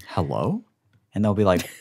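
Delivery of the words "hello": put-on tall man's voice. Answering a caller to show off height, lowered voice. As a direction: lower phone greeting, questioning. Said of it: "Can you hear it in my voice?".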